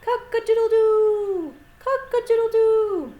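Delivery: high, loud crowing with a long final note; playful full-voiced rooster imitation. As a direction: crow out like a rooster.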